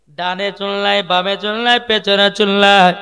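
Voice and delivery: loud rhythmic sermon listing; full-voice preaching. Acted. Preacher mocking hairstyles to the congregation, loud rhythmic preaching. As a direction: project each item to the crowd.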